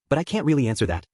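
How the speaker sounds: ordinary delivery, nothing marked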